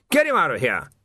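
loud angry bark; barked order. A narrator's reading. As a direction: bark it at the guard. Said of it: "he barked".

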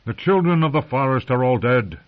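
loud, blunt dismissal; stern old commander. A narrator's reading. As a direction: shut the idea down. Mormont dismisses Sam.

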